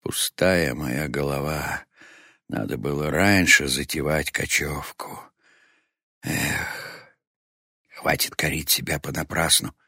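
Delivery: lightly voiced weary chief berating himself; self-reproaching old leader. Old chief blaming himself over the lost people, rueful.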